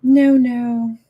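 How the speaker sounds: sharp loud double no. Real, not acted. Alarmed streamer blurting loudly at her hacked channel, loud dismay.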